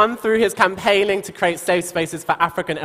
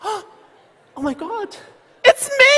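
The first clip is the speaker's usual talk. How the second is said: mock surprise. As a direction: fake shock at winning. Presenter jokes she won.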